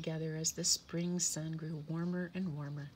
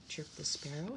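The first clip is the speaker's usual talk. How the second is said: ordinary delivery, nothing marked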